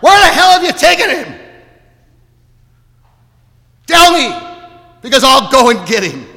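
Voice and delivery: high, loud shout of anguish; desperate, enraged demand at top pitch; despairing, angry cry. Acted. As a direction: cry out in full despair and anger. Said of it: "Shaking with despair and anger, she cries out".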